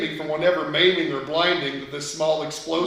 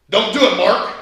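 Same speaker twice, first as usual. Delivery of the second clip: sharp, louder and higher urgent call; urgent warning; 5 semitones higher, 9 dB louder than usual. A narrator's reading. Older boy warns the kid away from the blasting cap, urgent and loud. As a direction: stop him before he drops the rock. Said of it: "Terry said urgently".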